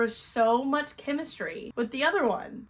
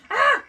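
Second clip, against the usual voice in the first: short, high, loud shriek; startled yelp; 9 dB louder than usual. Real, not acted. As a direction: yelp in sudden surprise.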